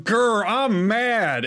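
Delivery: drawn-out cartoonish growl of anger; mock a tantrum, stretching it out slowly; mock angry. Retelling a queen's sudden rage, mock angry. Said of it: "starts getting angry and going".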